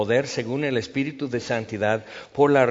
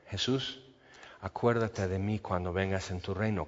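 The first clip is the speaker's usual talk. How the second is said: softer, hushed prayer of the thief; much softer plea to Jesus; 5 semitones lower, 10 dB quieter than usual. Retold or imitated. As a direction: say it barely above a breath, pleading.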